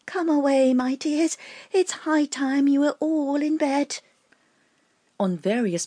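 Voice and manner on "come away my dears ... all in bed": high, slow trembling little bird; trembling canary voice. A narrator's reading. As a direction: quaver it like an anxious mother bird. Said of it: "in a trembling voice".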